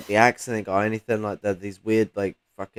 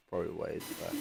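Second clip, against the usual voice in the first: softer, trailing repeat of probably ways back; quiet afterthought; 4 semitones lower, 13 dB quieter, faster than usual. Real, not acted. Echoing his own words to the listener, quietly trailing off. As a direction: let probably ways back fade away under your breath. Repeats phrase, second fades.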